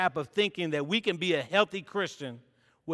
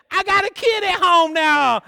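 higher, louder hammed-up plea; mock panicked plea; 11 semitones higher, 10 dB louder than usual. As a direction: beg your way out loudly. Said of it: "I said".

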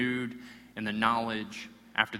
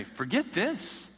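rising, higher, hammed-up outrage about coffee; mock melodrama; 12 semitones higher, 5 dB louder than usual. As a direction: ham up the no-coffee despair. Mock coffee-deprived rant.

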